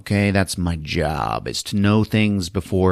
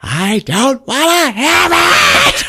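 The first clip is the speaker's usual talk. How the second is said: much higher, loud, slow yell; hammed-up shout; 8 dB louder, slower than usual. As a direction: belt out the refusal. Diane refuses him.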